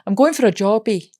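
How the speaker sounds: Scottish accent, jobby said as "tʃɔbi". Shown as a demonstration. Asked again, she repeats the line in Scottish accent. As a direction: give it a light Scottish lilt. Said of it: "Go on, say it again".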